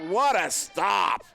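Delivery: loud, slow shouted call; excited shout. Real, not acted. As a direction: roar it like a caster after a huge save. Big save in the game.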